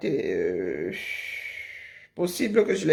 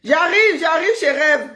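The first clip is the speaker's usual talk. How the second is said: higher and louder hammed-up announcing voice; mock arrival; 7 semitones higher, 5 dB louder than usual. Imitating the rival arriving, hammed-up and loud. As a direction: play her bursting in, overdone.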